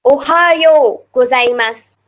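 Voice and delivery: slow Ohayo with a long, held "yo"; careful teaching demo, Ohayo drawn out to "ohayoo"; prolonged yo. Shown as a demonstration. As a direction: stretch the final o of "Ohayo" before gozaimasu. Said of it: "yo at the end of Ohayo is prolongated".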